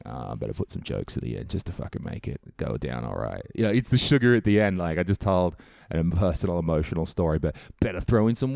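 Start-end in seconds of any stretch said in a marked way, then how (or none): 0.1-3.4 low, quiet grudging mutter to yourself; a lowered, half-hearted self-talk mutter; mock inner muttering. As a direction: grumble it under your breath like a tired comic. Comedian's inner voice, giving in.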